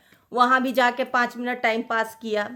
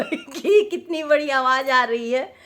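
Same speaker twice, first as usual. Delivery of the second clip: ordinary talk, nothing marked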